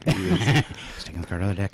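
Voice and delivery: low hushed echo of the announcer; hushed golf commentator. Pretend. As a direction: whisper it like golf TV. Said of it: "like a golf".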